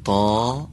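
«تا» with heavy t, vowel kept thick to the end. Shown as a demonstration. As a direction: hold the backed vowel in «تا» all the way. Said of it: "الفش تغییر نکنه، درشت تا آخر بمونه". («تا» درشت تا آخر بمونه)

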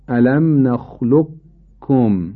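نخلقکم drawn out very slowly, qaf held without bounce; incomplete assimilation. Shown as a demonstration. Teacher repeating incomplete idgham, نخلقکم very slow with unreleased qaf. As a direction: stretch نخلقکم as nakhluq, then kum, no echo on qaf. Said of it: "به این ترتیب".

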